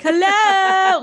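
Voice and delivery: loud high hello into the void; mock call while wandering around; hammed-up loud hello. Walk and yell approach.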